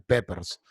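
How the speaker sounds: peppers with open Spanish vowels and a tapped r; Spanish-style peppers. Shown as a demonstration. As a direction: pepers the way a Spanish speaker reads it. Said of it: "como lo diríamos un hispano".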